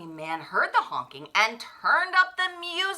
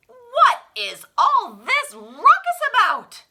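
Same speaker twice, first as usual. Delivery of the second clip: loud, high and stern; demanding policeman; 11 semitones higher, 10 dB louder than usual. A narrator's reading. Policeman confronting the noisy block, loud stern demand. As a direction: demand an answer. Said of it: "he asked".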